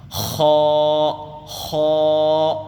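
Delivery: خا sung loud with a rough scrape; teacher chanting a rough خا; rasping letter. Shown as a demonstration. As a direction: scrape خا from the throat. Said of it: "کھردری آواز آتی ہے".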